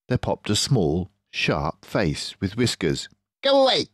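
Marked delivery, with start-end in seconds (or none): 3.4-3.9 high, loud snapping rat; snappy Rat. Acted. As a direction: snap it out like a cross rat. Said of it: "it snapped".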